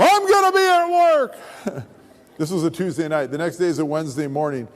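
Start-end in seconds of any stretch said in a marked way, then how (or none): 0.0-1.3 very high, loud holler; retold yell. Mark to the departing pastor, comically defiant yell. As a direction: yell it after someone leaving. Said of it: "I yell after him".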